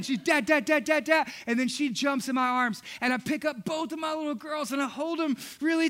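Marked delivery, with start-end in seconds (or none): 0.2-1.3 imitating a running toddler, high and loud; high, louder toddler chant; Ruth. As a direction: call it in excited little bursts. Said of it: "she can barely walk, but she's running".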